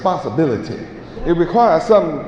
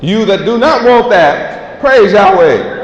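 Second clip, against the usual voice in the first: louder and slow, weighing each word; slow preaching; 5 semitones higher, 6 dB louder, slower than usual. Acted. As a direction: let it land slowly on the doubters. Preacher dismisses the unwilling.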